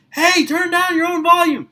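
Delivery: high, loud mock scold from his wife; wife telling him off. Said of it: "and my wife comes".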